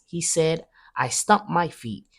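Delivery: plain reading voice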